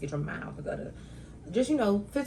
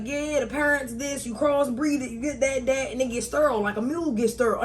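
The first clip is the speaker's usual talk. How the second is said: put-on higher, louder old man explaining; imitating old farmer; 7 semitones higher, 5 dB louder than usual. Old farmer explaining on a video, raised mock-lecturing voice.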